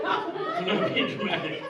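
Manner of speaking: squeaky laughing protest, slow; high breathless giggle on every word. Real, not acted. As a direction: laugh through it, insisting you're not exaggerating. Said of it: "laughs while insisting".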